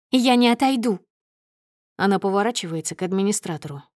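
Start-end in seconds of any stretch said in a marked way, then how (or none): 0.1-1.0 raised, defiant voice; angry refusal. A narrator's reading. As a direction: stand your ground loudly. Wife refuses to step aside, furious. (громко, с вызовом)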